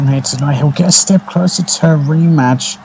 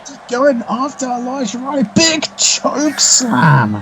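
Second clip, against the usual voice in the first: high-pitched call of a surprise attack; excited commentator; 10 semitones higher than usual. Real, not acted. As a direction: shout it out, caught off guard. Masked attacker hits the wrestler.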